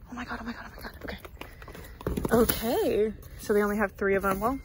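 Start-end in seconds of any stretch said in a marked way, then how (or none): 0.1-1.2 whispered, breathless awe; hushed, rapid-fire excitement; excited whisper. Real, not acted. Excited find in busy store.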